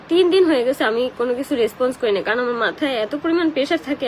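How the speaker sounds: ordinary talk, nothing marked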